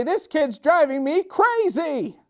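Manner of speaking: high, slow exasperated mom voice; frazzled mother. Acted. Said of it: "his mother would say".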